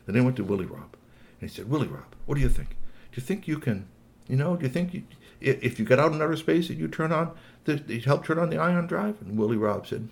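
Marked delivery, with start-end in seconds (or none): 1.7-9.2 lightly voiced toy, pitched up; a tentative, higher-pitched appeal; coaxing Squeaky Toy. Toy asking a robot for help, lightly coaxing.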